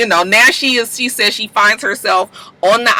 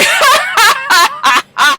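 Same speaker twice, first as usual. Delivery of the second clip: mock laughter. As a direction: burst into a big imitated laugh. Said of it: "just started laughing".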